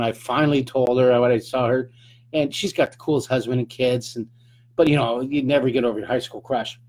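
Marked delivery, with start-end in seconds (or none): none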